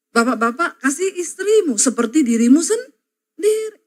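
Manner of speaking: normal talking voice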